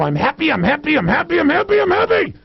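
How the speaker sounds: manic forced cheer, climbing very high; hammed-up frantic happiness; mad tone. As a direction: chant it like a crazed affirmation, rising each time. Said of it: "this mad look on your face and mad tone".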